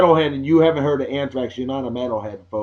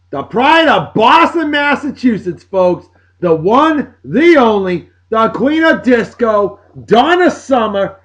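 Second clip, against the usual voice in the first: big showman build-up, higher and louder; hype announcer intro; 5 semitones higher, 4 dB louder than usual. Real, not acted. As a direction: sell her like a stage announcer. Announcing the babe of the day.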